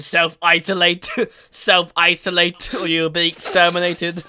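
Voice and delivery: loud, slow mechanical threat; blaring robot decree; Dalek. Retold or imitated. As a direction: imitate a ranting robot alien, stretching the syllables. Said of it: "that Dalek goes".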